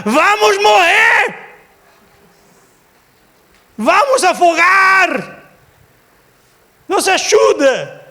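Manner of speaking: high, drawn-out desperate shouts; panicked shouting. Shown as a demonstration. Preacher shows disciples screaming in the storm, panicked shout. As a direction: yell for your life, stretching the words. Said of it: "a Bíblia diz que ele estava a gritar".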